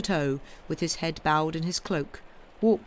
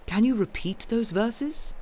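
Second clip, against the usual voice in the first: higher, eagerly rising question; 7 semitones higher than usual. A narrator's reading. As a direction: ask like a king keen to hear more. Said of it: "asked the king eagerly".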